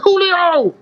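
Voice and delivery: high-pitched retold call across the gym. Lifter calling the desk guy to account, calling out.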